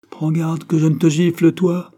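high, loud warning; scolding threat. A narrator's reading. Clerk warning the office boy, scolding threat. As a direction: snap a threat at a cheeky junior.

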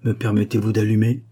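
ordinary delivery

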